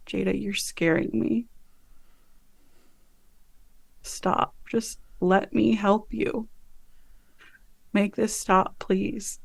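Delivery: soft, halting, half-whispered pleading; hushed plea. Acted. Lou to Jada who is scaring them, hushed plea. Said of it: "whispers to her".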